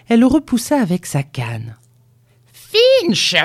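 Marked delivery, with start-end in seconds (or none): 2.5-3.4 loud, high-pitched shout of a name; old woman's cry. A narrator's reading. Furious grandmother summons the boy, crying out. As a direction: call out imperiously, pitched way up. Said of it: "cria-t-elle".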